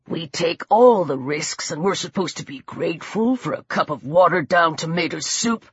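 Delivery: low, softened grumble; menacing low man's voice; resentful attacker. A narrator's reading. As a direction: complain deep and bitter. Attacker's grievance to victim.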